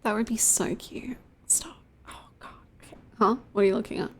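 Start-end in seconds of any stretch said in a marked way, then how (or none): 0.0-3.0 whisper. Real, not acted. Cooing over cats in whisper.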